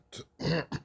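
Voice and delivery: loud throat clear. Real, not acted. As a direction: clear your throat loudly. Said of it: "excuse me".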